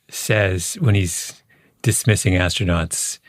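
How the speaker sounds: ordinary talk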